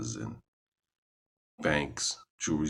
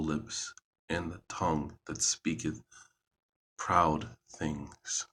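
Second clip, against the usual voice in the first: scripture reading. Acted. Reading a psalm aloud to listeners, solemn and measured. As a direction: read it out like scripture in church.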